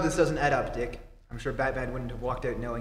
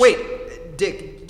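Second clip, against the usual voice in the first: sudden interruption; 5 semitones higher, 8 dB louder than usual. Acted.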